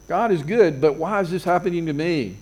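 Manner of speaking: usual speaking voice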